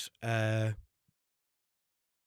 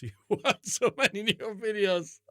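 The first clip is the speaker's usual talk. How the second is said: high, slowed words broken by laughter; laughing; 7 semitones higher than usual. Real, not acted. Podcaster amused by parents' Peppa complaints, helpless laughing. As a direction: laugh your way through the words.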